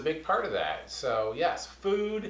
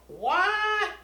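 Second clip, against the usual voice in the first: very high squeaked what; teacher acting amazed at the secret; mock shock. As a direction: fake astonishment, high. Surprised reaction to secret.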